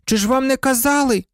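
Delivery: high-pitched pupil cutting in; sudden high girlish outcry; sudden exclamation. A narrator's reading. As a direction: burst in with a high, surprised question. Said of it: "вигукнула раптом Парваті".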